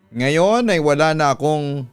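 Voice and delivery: ordinary delivery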